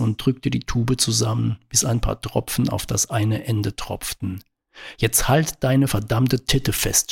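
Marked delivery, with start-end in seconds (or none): none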